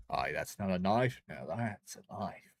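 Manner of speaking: movie-quote Australian, slowing at the end; mock Aussie accent. Streamer quoting a film line at the knife, mock Aussie accent. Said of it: "Hey, look, an Aussie".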